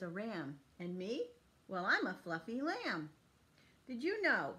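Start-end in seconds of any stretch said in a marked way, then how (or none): none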